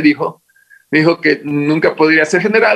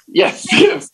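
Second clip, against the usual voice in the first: laughing. Retold or imitated. Laughing at own clumsy English.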